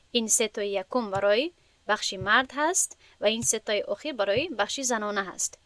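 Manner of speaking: ordinary talk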